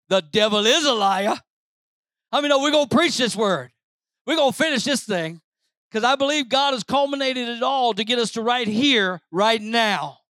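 rising Pentecostal preaching. Acted. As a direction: preach it to the rafters. Preaching at full force.

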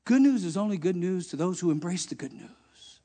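ordinary talk, nothing marked

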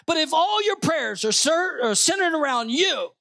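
high-pitched, insistent pulpit exhortation; impassioned preaching. Real, not acted. Pastor challenging the church on self-centered prayers, high and insistent.

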